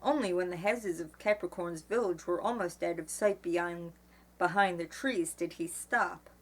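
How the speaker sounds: plain reading voice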